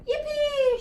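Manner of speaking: very high, loud whoop; joyful yell. A narrator's reading. George finding another monster, loud joyful yell. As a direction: let it burst out with delight. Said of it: "shouted George".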